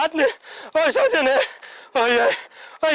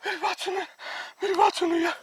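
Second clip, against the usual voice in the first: soft, higher-pitched plea; hushed pleading; 4 semitones higher, 7 dB quieter than usual. Acted. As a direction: beg softly to be heard. Scolded man pleads to be heard. (दबी आवाज़ में मिन्नत)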